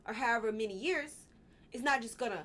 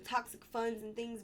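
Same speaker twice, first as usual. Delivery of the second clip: normal talking voice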